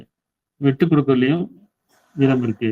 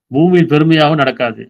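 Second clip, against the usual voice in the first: verse reading; 2 semitones higher, 4 dB louder than usual. Shown as a demonstration. Quoting a verse, then explaining.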